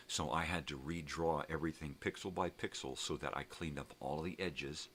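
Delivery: usual speaking voice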